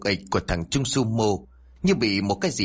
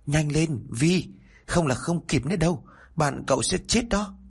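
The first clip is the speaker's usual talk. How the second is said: put-on a raised, pressing voice from an unseen girl; urgent ghostly warning; 6 semitones higher than usual. A narrator's reading. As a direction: urge her on before it is too late. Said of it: "gấp gáp hơn như thúc giục". (giọng hồn ma thúc giục gấp gáp)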